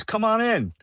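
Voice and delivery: high, bright greeting of a chirpy nurse; cheery nurse. Retold or imitated. Nurse greeting the newcomer at detox, mock high and cheery. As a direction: welcome him in like a chipper little nurse.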